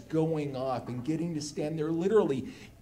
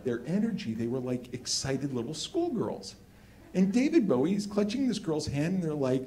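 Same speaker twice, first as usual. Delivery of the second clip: ordinary talk, nothing marked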